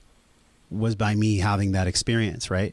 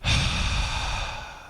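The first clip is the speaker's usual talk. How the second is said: long unvoiced exhale; big breath out. Shown as a demonstration. Guest showing the breath practice to the host, long exhale. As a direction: demonstrate a deep release breath. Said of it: "is when you go like this".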